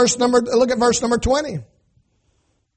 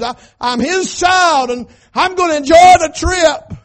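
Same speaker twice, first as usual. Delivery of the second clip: rejoicing; 7 semitones higher, 9 dB louder than usual. Acted. Preaching David's rejoicing.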